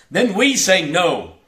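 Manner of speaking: loud, high defiant refusal; defiant shout. A narrator's reading. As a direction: shout your no at the pirates.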